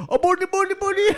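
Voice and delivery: high, loud gibberish English voice; hammed-up high-pitched Englishman; mock English guy. Retelling an English guy to friends, high loud mock voice. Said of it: "There was this English guy".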